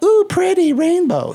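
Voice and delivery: put-on high, delighted child voice; childlike wonder. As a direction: gush like a little kid at a rainbow. Imitating kids seeing rainbow.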